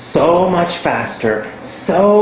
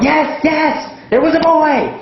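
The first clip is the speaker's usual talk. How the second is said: high excited shouted yes; eager shout; 13 semitones higher than usual. Retold or imitated. Student shouting the answer to the teacher, high and excited. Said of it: "Again, you shout".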